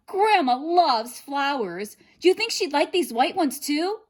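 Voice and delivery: put-on high, loud eager girl; girl's voice. A narrator's reading. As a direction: exclaim like a delighted little girl. Girl asks wolf.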